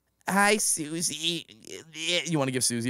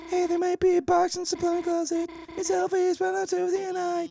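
put-on much higher, nervous girl; high squeaky voice. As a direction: squeak it out shyly.

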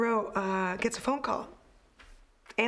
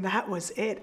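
ordinary talk

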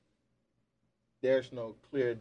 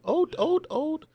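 much higher, louder refrain; high dramatic wail; 7 dB louder than usual. Acted. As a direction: soar up on each ode. Climactic poem refrain.

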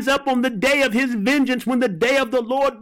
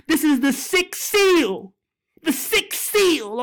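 ordinary delivery

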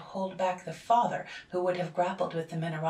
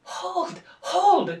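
much higher and louder, alarmed; alarmed cry; 14 semitones higher, 13 dB louder than usual. A narrator's reading. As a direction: cry out to stop her. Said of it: "cried the relations".

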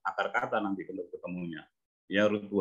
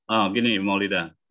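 ordinary talk

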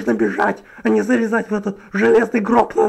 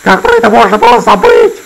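shocked disbelief; 10 semitones higher, 5 dB louder than usual. Acted. Shocked at forgotten button.

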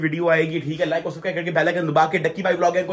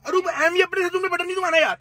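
mock panicked scolding; 11 semitones higher than usual. Panicked scolding of driving wife.

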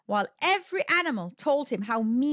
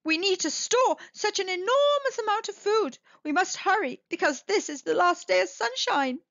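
anxious urgency; 8 semitones higher than usual. A narrator's reading. Beaver warns of endless winter.

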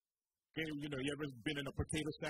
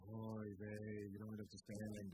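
lower, softer put-on sigh of woe; quiet, lower mock lament; mock mourner; 8 semitones lower, 9 dB quieter, slower than usual. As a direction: ham up the self-pitying mourner. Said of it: "the person will say".

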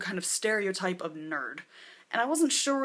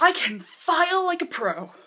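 higher and louder, bragging; eager boast; 8 semitones higher, 6 dB louder than usual. Retold or imitated. Film major boasting to the casting agent, eagerly. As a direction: oversell your one skill. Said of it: "And I was like".

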